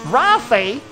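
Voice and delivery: "Rossi" in a mock Italian accent, the s lisped; an Italian accent plus a lisp on "Rossi"; Italian-accented "Rossi", the s turned to th. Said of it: "do the accent with a lisp".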